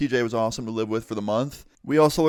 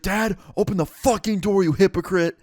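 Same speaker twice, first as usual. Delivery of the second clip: higher, louder furious demand at the door; angry yelling; 7 semitones higher, 3 dB louder than usual. Retold or imitated. Son yelling at his dad through the bedroom door, angry shout. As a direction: bang on the door and yell at him.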